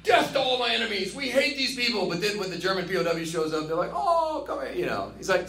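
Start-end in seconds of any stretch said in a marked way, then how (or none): 0.0-2.1 high-pitched, hammed-up battle cry; exaggerated mock-angry declaration; mock hatred. Acts out bloodthirsty English haters. 3.9-4.7 high, cooing welcome; mock tenderness. Haters soften on meeting the pilot.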